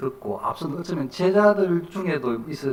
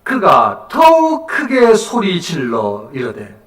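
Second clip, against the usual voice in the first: loud, very slow declaimed reading; emphatic scripture proclamation; 9 dB louder, much slower than usual. A narrator's reading. Preacher reading the verse to the congregation, loud proclamation. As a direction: draw out the words and project.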